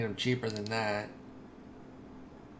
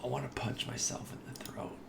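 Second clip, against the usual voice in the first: frustrated whisper; 10 dB quieter than usual. Real, not acted. Frustrated about hiccups.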